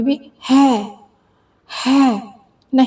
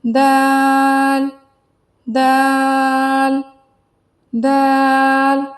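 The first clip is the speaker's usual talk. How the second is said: slow drawn out daal; much slower than usual. Shown as a demonstration. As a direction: stretch दाल long and slow. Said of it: "दाल को देखते हैं".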